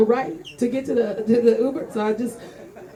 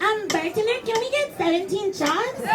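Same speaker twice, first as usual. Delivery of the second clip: put-on higher, slower valley girl order; 10 semitones higher, 3 dB quieter than usual. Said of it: "they're like".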